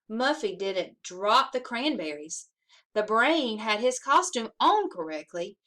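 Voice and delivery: usual speaking voice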